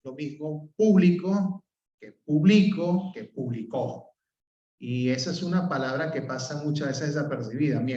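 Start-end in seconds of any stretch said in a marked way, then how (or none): 0.8-1.6 público, stress on the first syllable: PÚ-bli-co; esdrújula público, first syllable stressed. Shown as a demonstration. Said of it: "va a cambiar su pronunciación". 2.2-3.1 publico, stress on the middle: pu-BLI-co. Shown as a demonstration. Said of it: "va a cambiar su pronunciación". 3.2-4.1 publicó, stress on the last syllable: pu-bli-CÓ. Shown as a demonstration. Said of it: "va a cambiar su pronunciación".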